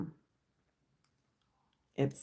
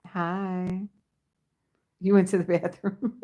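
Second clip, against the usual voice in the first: ordinary delivery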